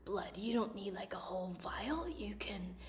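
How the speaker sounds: hushed, slow, breathy low mock pitch; a soft, slow put-on voice, partly whispered; Elizabeth Holmes voice. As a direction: imitate her put-on low voice softly. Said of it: "she lowered her voice so she sounded, like, more masculine".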